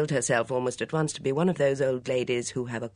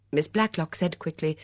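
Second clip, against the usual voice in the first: ordinary delivery, nothing marked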